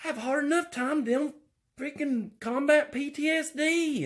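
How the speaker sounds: high, put-upon whine; pleading, high complaining voice; whiny high voice. Acted.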